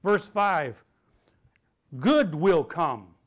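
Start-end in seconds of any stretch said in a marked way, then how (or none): 2.0-3.2 slow declaration. Shown as a demonstration. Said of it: "Everybody say".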